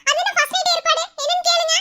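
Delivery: high, loud outraged question; indignant surprise. Acted. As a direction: protest in shock, voice shooting up. Wife objects to wedding-night plans.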